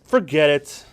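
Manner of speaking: loud brush-off; hammed-up refusal; dismissive outburst. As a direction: shut the argument down. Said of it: "And scene".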